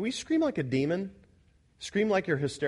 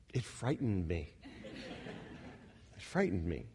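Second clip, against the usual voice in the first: laughing; 11 semitones lower, 12 dB quieter than usual. Real, not acted. Laughing at memory of screaming.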